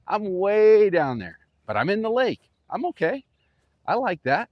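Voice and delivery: ordinary delivery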